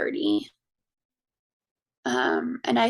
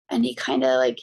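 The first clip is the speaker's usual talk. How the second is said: ordinary talk, nothing marked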